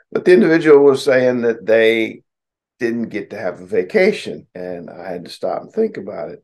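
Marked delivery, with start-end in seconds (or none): none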